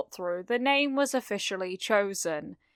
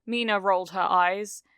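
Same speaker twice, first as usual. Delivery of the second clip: ordinary delivery, nothing marked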